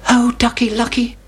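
high anxious little chick; Chicken Licken. Acted. Chicken Licken telling Henny Penny, high and anxious. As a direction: squeak it out like a worried chick. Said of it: "said Chicken Licken".

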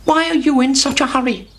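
high, curious hen voice; Henny Penny. A narrator's reading. Hen asks the hurrying chick why, high-voiced. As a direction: ask it as a high-pitched storybook hen. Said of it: "said Henny Penny".

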